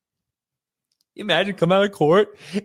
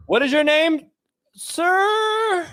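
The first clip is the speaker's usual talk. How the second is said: high voice; 7 semitones higher, much slower than usual. Pretend. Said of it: "meeting public defender, high".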